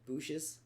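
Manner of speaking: "bushes" with a long oo, like "booshes"; local pronunciation of bushes. Shown as a demonstration. One of them called "bushes" booshes, long oo. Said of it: "One of them called".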